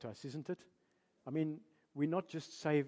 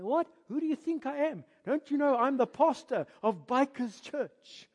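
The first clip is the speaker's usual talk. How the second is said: indignant pastor; 8 semitones higher, 8 dB louder than usual. Retold or imitated. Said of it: "I say".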